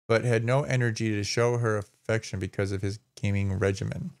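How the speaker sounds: ordinary talk, nothing marked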